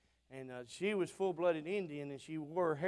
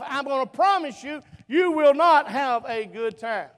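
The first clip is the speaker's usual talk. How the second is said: loud, rising revival preaching; preaching at full force; 7 semitones higher, 10 dB louder than usual. Acted. Preacher scolding his congregation in loud revival preaching. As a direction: drive it home to the pews.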